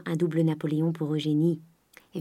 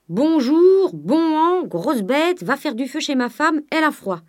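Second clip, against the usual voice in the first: high, loud mimicked old man; imitating the master; 9 semitones higher, 6 dB louder, slower than usual. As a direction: parrot the master's gruff greeting. Nanon imitates Grandet's greeting. (imitation du maître)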